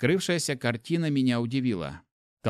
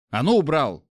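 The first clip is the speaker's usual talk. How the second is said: loud barked order; angry command; 3 semitones higher, 6 dB louder than usual. Acted. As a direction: snap at him to drop it. Hero orders knife down.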